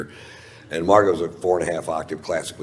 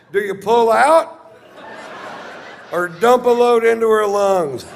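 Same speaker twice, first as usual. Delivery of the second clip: high, loud, slowed declaiming; full-force shouted stage routine, pitched high; bellowed comedy bit; 6 dB louder than usual. Retold or imitated. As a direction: holler the crude bit to the whole crowd. Old vile bit performed for straight guests.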